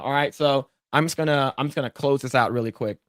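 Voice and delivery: ordinary talk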